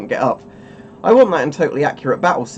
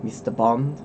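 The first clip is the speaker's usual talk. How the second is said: Ernst Stavro Blofeld; 4 dB quieter, slower than usual. Pretend. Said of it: "I suppose I do sound like Ernst Stavro Blofeld".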